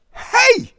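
put-on loud, very high boyish yell; crying out. A narrator's reading. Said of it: "Derek cried".

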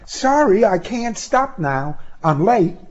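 high-pitched voice of a woman excusing herself; high, apologetic brush-off; hurried young woman. Acted. Woman rushing off, voiced by narrator.

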